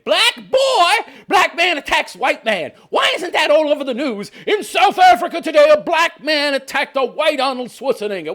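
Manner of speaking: loud, high, mock-outraged announcement; indignant headline rant. Reactor railing at the media for ignoring the attack, indignant rant. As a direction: proclaim it loudly like a scandalous headline.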